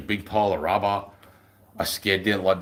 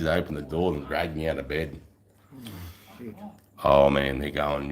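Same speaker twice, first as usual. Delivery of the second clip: usual speaking voice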